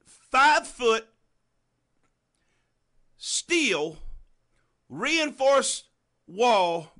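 loud, punched preaching build; full-force preaching. Acted. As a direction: hammer each image home to the congregation. Preaching the train image.

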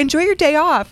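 high, bright imitation of people; cheery mock voice. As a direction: chirp it like a cheerful well-wisher. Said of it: "I've had people be like".

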